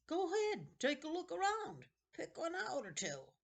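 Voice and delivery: put-on high, eerie old man's voice; a wheedling ancient keeper of books; creepy old librarian. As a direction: play a strange, eager old librarian. Librarian character voice.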